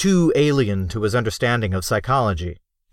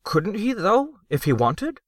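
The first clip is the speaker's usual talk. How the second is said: Crockett's voice raised high, hopeful; hopeful question; 11 semitones higher than usual. Acted. Crockett pressing the gnome, high and hopeful. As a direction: ask it high, with a rising though. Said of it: "had spoken this thought aloud".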